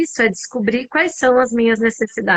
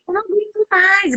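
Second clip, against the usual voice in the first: retold yelling; 8 semitones higher than usual. Mother at her limit yelling at the kids, retold shout. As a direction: re-enact losing it, pitch jumped high. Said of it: "gritando, aos berros, assim".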